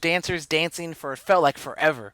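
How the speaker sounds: indignant and emphatic; worked-up rant. Real, not acted. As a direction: rant as someone tries to calm you. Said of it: "All right, calm down".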